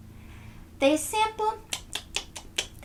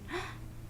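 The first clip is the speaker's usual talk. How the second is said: gasp; 8 semitones higher, 13 dB quieter than usual. Acted. Suspense gasp.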